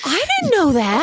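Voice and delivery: high gasp of surprise; surprised gasp. Real, not acted. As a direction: caught off guard by the news. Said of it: "surprised by news".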